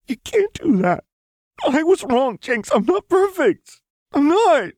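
very high, tearful wail; crying. A narrator's reading. Lux refusing Jinx's forgiveness, crying out. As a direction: protest desperately through sobs. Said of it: "Lux cried into Jinx's hair".

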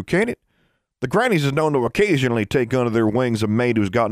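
a good-natured mountain man teasing her; hill-folk brother. A narrator's reading. Burton's hill voice, higher.

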